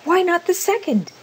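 high-pitched, eager urging; feverish. A narrator's reading. Old woman pressing her husband to wish, high and feverish. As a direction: press him, voice leaping high. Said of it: "said the old woman feverishly".

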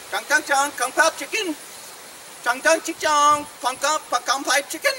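hammed-up fake Chinese, rising at the ends; mock Chinese. Showing off the horse's languages, mock Chinese. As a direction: pretend to speak Chinese with nonsense syllables. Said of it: "Now I'll do some Chinese".

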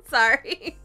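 sorry through a laugh; laughing apology. Real, not acted. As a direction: apologize while still giggling. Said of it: "I was trying to say that without laughing".

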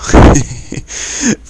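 loud, unvoiced burst of laughter; real breathy laugh. Real, not acted. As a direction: crack up loudly mid-aside. Laughs during aside to a friend.

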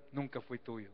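ordinary talk, nothing marked

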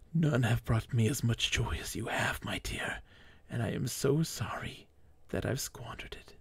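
soft, breaking, half-whispered goodbye; grieving husband's choked whisper to his dead wife; choked farewell. Acted. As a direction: choke the words out over her body. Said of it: "he choked".

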